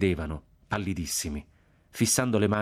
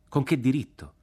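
raised, indignant demand; challenging question; 7 semitones higher than usual. A narrator's reading. Soldier challenges officer's right to kill, challenging question. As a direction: demand an answer, pitched higher.

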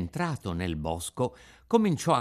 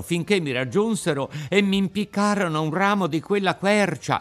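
higher, louder, breathless retelling; frightened puppet; 6 semitones higher, 5 dB louder than usual. Acted. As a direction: relive the chase. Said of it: "continuò a dire il burattino". (Pinocchio racconta spaventato, voce alta)

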